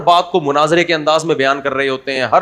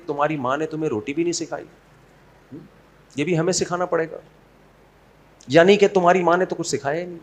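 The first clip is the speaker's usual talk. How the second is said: hushed, sugar-coated taunt; sweetly venomous; 7 dB quieter, faster than usual. Acted. Mother-in-law taunting daughter-in-law about her upbringing, softly and sweetly. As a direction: needle her softly under a polite tone. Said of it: "بڑے میٹھے لہجے میں بول لے".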